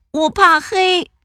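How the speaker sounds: high small cub voice; timid little bear. Acted. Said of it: "小小熊说".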